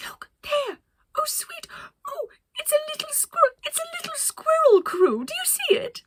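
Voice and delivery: very high, loud delighted squeals; Penelope squealing at a squirrel to her crew; thrilled. Acted. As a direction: gush over the little squirrel.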